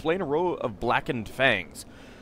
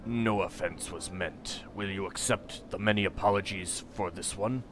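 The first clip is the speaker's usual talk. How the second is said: solemn stranger; 3 semitones lower, 6 dB quieter than usual. Acted. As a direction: speak as a grave foreigner. Voices Porphyron apologizing.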